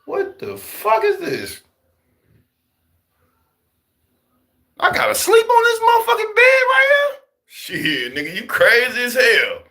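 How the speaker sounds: high, shocked voice rising to a shout at the bed; mock outrage, voice jumping up high; shocked disbelief. New inmate reacting to his jail bed, shocked high shout. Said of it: "I walked in that bitch like".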